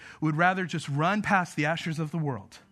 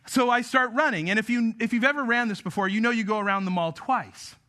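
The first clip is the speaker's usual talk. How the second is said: usual speaking voice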